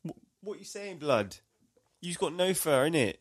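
high-pitched chav taunting; chav trash talk. Acted. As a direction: goad the bear like a chav. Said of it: "trash talking a brown bear".

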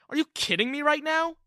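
can't believe it; shocked outburst. Acted. Son shouting disbelief at his father.

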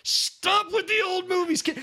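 very high, drawn-out wail; exasperated plea. Real, not acted. Contestant begs host to drop old films, exasperated. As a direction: plead in comic exasperation.

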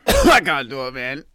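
usual speaking voice

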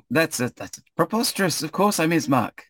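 high-pitched affronted denial; high, offended character voice; indignant protest. Acted. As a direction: protest that you are who you say. Ismark protests when doubted.

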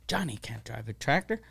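low, soft dismissive reply; quietly matter-of-fact, dropped in pitch; quoted dad. Retold or imitated. Dad's dismissive answer, retold.

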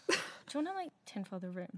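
conspiratorial aside. Retold or imitated. Retelling a prank pitched to a flatmate, soft conspiratorial voice. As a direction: float the idea under your breath.

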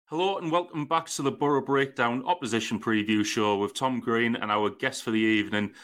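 ordinary talk, nothing marked

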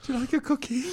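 high, soft eager question; timid server voice. Acted. Server offering a cookie, high and soft. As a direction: offer a cookie in a meek falsetto. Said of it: "Subway server".

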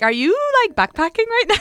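high, loud surprised question; mock surprised question. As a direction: ask it with mock amazement. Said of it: "I'm like".

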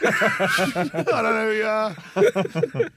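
mock embarrassed laugh; laughing confession. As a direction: crack up while admitting you don't know him. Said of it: "He goes".

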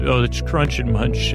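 usual speaking voice

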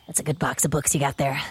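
a hint of a low, soft, sly murmur, quick; lightly voiced sneaky goose sizing up the loot. Player voicing Brian the goose sidling up to T K, soft and sly. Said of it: "sidle up to T K and go".

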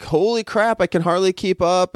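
high, overwhelmed mock voice; stressed pancreas. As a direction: play an overworked organ struggling to cope. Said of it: "the pancreas just being like".

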